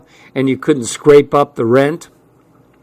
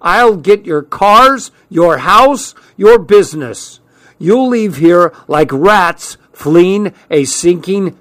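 high, drawn-out angry shout; shouting threats; 5 semitones higher, 3 dB louder, slower than usual. A narrator's reading. Man yelling threats from the driveway, shouting. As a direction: shout each threat slowly, pitched up. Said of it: "before shouting".

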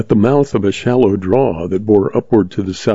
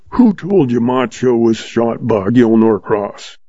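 ordinary delivery, nothing marked

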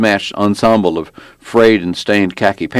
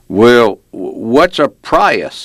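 slow, puzzled, higher-pitched cop question; belligerent; 5 semitones higher, 3 dB louder, much slower than usual. A narrator's reading. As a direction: draw out the unfamiliar word suspiciously. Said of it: "his belligerent tone was clear".

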